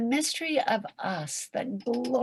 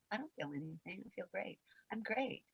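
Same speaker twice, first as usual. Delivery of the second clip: very soft, smiling denial; forced smile; 14 dB quieter, faster than usual. Acted. Someone hiding fear inside, quiet put-on smile. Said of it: "we put a smile on it".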